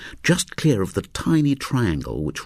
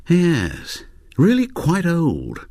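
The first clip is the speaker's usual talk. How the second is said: put-on old man voice; 10 semitones higher, much slower than usual. Old man's voice in story.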